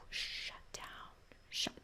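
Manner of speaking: drops to a breathless whisper as she shuts down. Pretend. Creator showing viewers how she shuts down when upset, whispering. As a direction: let the words die into a whisper.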